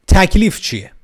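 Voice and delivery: sudden high, loud outburst; playfully shouted, high-pitched question. Pretend. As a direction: burst out with the question at full volume. Repeats whispered question out loud.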